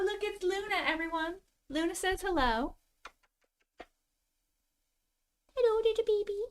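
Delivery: high, sweet pet talk from far off; coaxing a cat. Real, not acted. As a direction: welcome a cat in with high, distant cooing. Coaxing a visiting cat inside.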